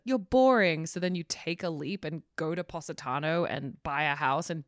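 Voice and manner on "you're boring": mock British woman. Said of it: "British woman is like".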